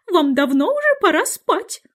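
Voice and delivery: high, quavering mother bird; fluttery, anxious call to the chicks; trembling canary. A narrator's reading. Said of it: "канарейка дрожащим голосом звала своих птенчиков". (высоко, дрожащим голосом)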